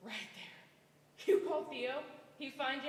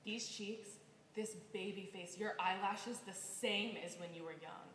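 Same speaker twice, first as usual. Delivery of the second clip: soft, lower tender voice; 5 semitones lower, 10 dB quieter than usual. Acted. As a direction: gently comfort a grown child. Aunt tenderly comforting niece.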